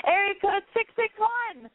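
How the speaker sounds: high, slow announcer call-out; radio host announcing. Acted. Host calls up the next caller on air, high and slow.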